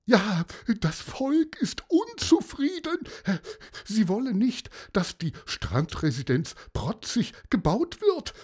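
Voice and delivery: very high, fretful adviser voice; increasingly upset adviser. Acted. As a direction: let the aide's worry climb. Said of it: "Der Berater regte sich immer mehr auf".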